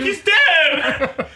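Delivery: loud, high-pitched announcement; mock alarmed shout. Co-host joking about bringing his friend to hospital, mock alarmed shout. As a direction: cry it out melodramatically, laughing. Acting a hospital arrival as a joke.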